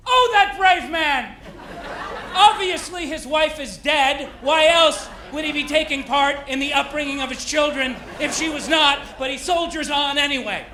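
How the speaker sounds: high, loud, hammed-up sympathy; gushing mock pity. People on Facebook pity a dad who drives his kids, in mock-tragic admiration.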